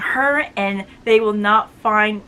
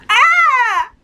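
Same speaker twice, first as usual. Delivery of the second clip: loud high burst of excitement; excited cry; 13 semitones higher, 7 dB louder than usual. Real, not acted. Reviewer gushing over the novella with a loud high yelp. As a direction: let out a thrilled yelp.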